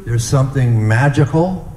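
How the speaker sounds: ordinary talk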